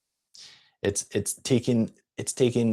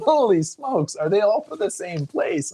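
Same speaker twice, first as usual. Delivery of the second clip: much higher, an imitated amazed exclamation; amazed clerk; 8 semitones higher than usual. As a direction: mimic the clerk's astonished gasp of disbelief. Clerk's amazed reply retold.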